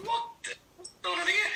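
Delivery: high squeaky shocked question; baffled. Retold or imitated. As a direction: sound totally bewildered. Baffled seeing crews brawl.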